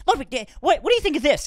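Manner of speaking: high, loud interviewer voice; hammed-up, pushy celebrity interviewer; Nardwuar. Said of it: "Nardwuar like".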